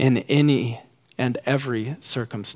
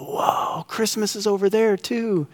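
put-on breathy whoa, then a higher child's wonder; toddler voice; 7 semitones higher than usual. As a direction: sound like an amazed two-year-old. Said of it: "my two-year-old in the backseat go".

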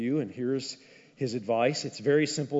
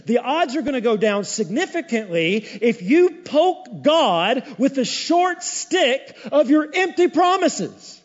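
louder, higher, rising preacher's push; preaching at full force; 7 semitones higher, 6 dB louder than usual. Acted. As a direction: drive the warning home. Preaching builds to a climax.

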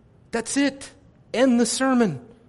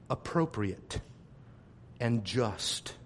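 much lower, much softer, grave; solemn hush; 9 semitones lower, 9 dB quieter than usual. Acted. Preacher to congregation, solemn on eternal hell, grave hush. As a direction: drop to a grave near-whisper.

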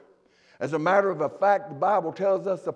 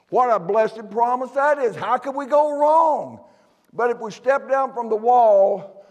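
raised, ringing preacher voice, higher and louder; full-force preaching; 7 semitones higher, 7 dB louder than usual. Acted. Preacher urging the church to the house of the Lord, full force. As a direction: drive the call home to the congregation.

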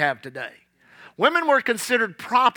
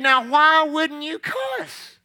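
slow probing question; 6 semitones higher, much slower than usual. Retold or imitated. Pastor probing the woman.

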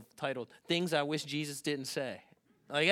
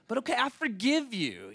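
high, swooping grudging pardon; acted fed-up concession; exasperated forgiveness; 9 semitones higher, 6 dB louder than usual. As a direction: give in with pitched-up exasperation. Second time, exasperated forgiveness.

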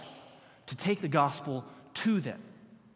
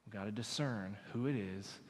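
much softer, low intimate preaching; hushed sermon; 8 semitones lower, 9 dB quieter than usual. Acted. Pastor speaking softly to the church about Jesus' dying words. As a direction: draw them in close and quiet.

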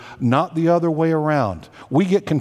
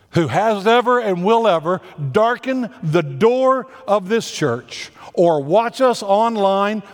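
raised, insistent pulpit delivery; preaching; 6 semitones higher than usual. Acted. New senior pastor urges everyone in his first sermon, fervent. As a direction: press the point home to the whole church.

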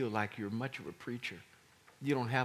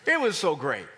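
very high, very loud, gleeful preaching; ecstatic shouting; 10 dB louder than usual. Real, not acted. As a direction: shout it like you can't contain the joy.

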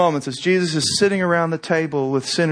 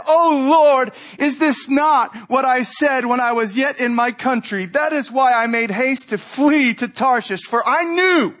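angry prayer; 7 semitones higher than usual. Retold or imitated. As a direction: hurl it up at the Lord. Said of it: "and he was angry".

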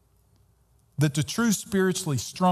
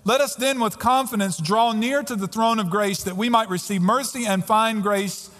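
raised, measured scripture read; preacher reading Hebrews aloud; 5 semitones higher than usual. Acted. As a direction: proclaim the verses over the music. Reads scripture over music.

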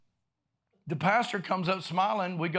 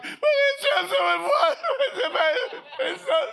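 very high, loud comic wail; hammed-up squealing sob; mock bawling; 8 dB louder than usual. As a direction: imitate bawling like a baby, half laughing. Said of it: "I'm crying like a baby".

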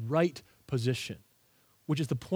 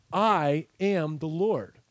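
slow weighty refrain; much slower than usual. Shown as a demonstration. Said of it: "these sentences all end the same way".

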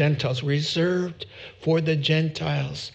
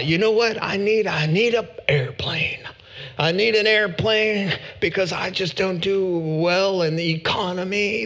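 mock a southern TV preacher begging for a jet; southern televangelist. Preacher lampooning a late-night TV evangelist in a southern accent. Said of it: "I don't know why it went southern".

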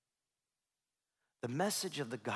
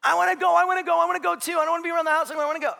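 put-on much higher, loud whiny kid; jealous child; 12 dB louder, much faster than usual. Father imitating jealous son wanting to go too. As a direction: whine like a jealous little brother. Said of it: "brother whining".

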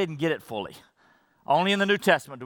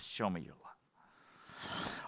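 whispered, very slow and soft; hushed prayer; 10 semitones lower, 18 dB quieter, much slower than usual. Acted. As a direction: breathe the last plea. End of a quoted prayer.

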